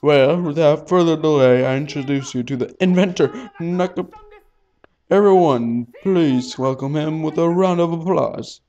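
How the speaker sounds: loud formal emcee voice; event host. Acted. Host introducing the inventor, loud and formal. Announcer at conference.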